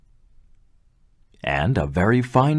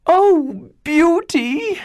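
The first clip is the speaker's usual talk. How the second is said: very high, loud wail of dismay; shrill sisters' cry; 5 dB louder, much slower than usual. Acted. Older sisters crying out to Beauty, very high loud wail. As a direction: sisters crying out at the bad news. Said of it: "cried the older sisters".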